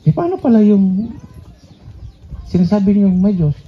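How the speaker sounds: ordinary talk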